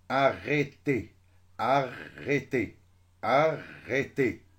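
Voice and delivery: "Arrêtez" slowly, broken into loud separate syllables; syllable split. Shown as a demonstration. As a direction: hammer out each syllable of "Arrêtez". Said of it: "راح نقسم les deux syllabes". (« Arrêtez » lentement, syllabe par syllabe)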